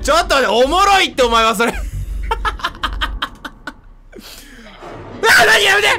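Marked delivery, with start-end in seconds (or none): 1.8-5.4 声にならない高い泣き笑い; 息を漏らして笑い泣く; 息だけの泣き笑い. Real, not acted. 笑いすぎて泣き笑い. (声にならない泣き笑い)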